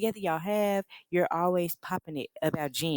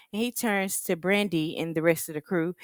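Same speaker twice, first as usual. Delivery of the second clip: usual speaking voice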